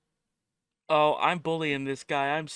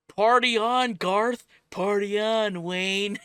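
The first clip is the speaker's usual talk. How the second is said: Wayne's World impression; 6 semitones higher, 5 dB louder, slower than usual. Wayne's World catchphrase quoted.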